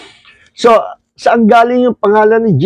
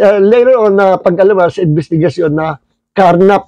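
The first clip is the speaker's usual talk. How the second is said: ordinary talk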